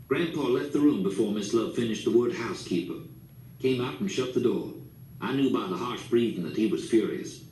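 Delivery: usual speaking voice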